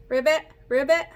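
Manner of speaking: ribbit said like a frog; playful frog noise; frog croak. Shown as a demonstration. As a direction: croak it out for the kids. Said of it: "you're gonna say the sound of the frog".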